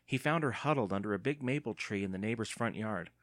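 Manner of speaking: ordinary delivery, nothing marked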